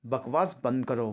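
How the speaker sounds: usual speaking voice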